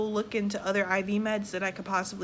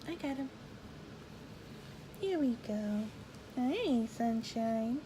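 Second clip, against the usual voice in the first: much softer, lilting mother's voice; 9 dB quieter, slower than usual. Real, not acted. Mother picking up her baby mid-story, real tender murmur.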